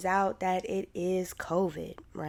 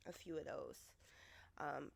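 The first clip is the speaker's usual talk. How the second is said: ordinary talk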